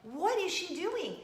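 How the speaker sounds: delighted disbelief at the stage; amazed. Retold or imitated. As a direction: squeal of surprise turning to wonder. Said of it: "I'm like".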